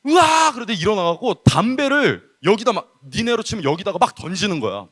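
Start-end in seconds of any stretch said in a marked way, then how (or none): 0.1-0.5 high mock wail of repentance; mimicked wail. Youth preacher mimics a repenting older boy, high wailing outburst. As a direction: burst out in an imitated cry.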